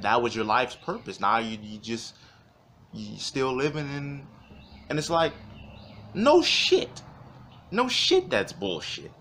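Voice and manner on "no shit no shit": sarcastic no shit. Real, not acted. Sarcastic high exclamation.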